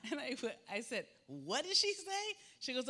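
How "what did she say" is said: surprised. Real, not acted. Surprised at toddler's words.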